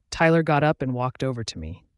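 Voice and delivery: ordinary delivery, nothing marked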